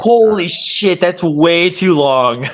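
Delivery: high, slow, stretched-out shock; shocked disbelief. Real, not acted. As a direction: drag it out, floored by the news. Hearing about sixteen-hour trip.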